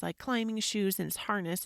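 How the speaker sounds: normal talking voice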